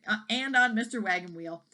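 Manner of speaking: usual speaking voice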